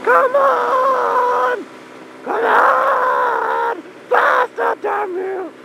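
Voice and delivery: high drawn-out yell; urging shout. Real, not acted. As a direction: spur it on with high cries.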